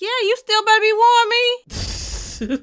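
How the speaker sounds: playful high-pitched insistence; high sassy demand. Real, not acted. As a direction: squeal it high and slow.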